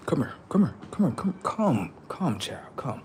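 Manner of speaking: hushed, creepy call to come close; whispered beckoning. Acted. Alien whispering to lure a sleeping boy closer. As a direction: whisper them over like a lurking alien.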